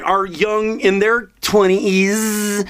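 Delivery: usual speaking voice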